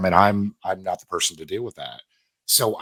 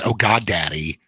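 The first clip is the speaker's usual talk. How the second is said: mock moaning; 4 dB louder than usual. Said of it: "my A S M R trigger is".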